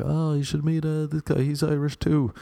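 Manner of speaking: high-pitched imitation of an eager friend. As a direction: mimic the friend, pitched well up. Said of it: "a friend of a friend was like".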